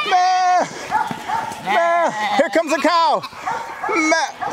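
very high bleating goat call; high singsong animal-herding calls mixed with mock bleats. Real, not acted. Calling goats with bleats.

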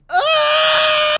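piercing high scream; loud yell. Real, not acted. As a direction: yell right into their ears. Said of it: "I'm gonna yell it in your ears".

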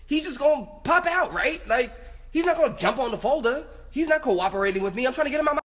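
worked-up squeaky complaint; flustered worry. Real, not acted. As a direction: fret high-pitched over a failing plan. Frets that the plan fails.

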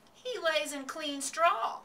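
slow, high, emphatic correction; loud, stretched-out rebuttal; correcting. A narrator's reading. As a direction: set them straight, drawing out each word. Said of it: "corrected Mister Zuckerman".

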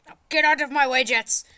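high, loud angry order; exasperated yell. Pretend. Player orders the jets out of the way, exasperated. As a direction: shout the obstacle aside.

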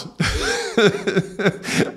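breathy high laugh; real high-pitched laughter; high giggle. Real, not acted. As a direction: laugh at yourself. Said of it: "I'm almost crying and laughing".

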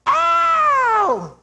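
very high wailed "ow"; re-enacted piercing cry, falling at the end; imitated cry. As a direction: mimic a man's sudden high-pitched holler. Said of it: "he goes".

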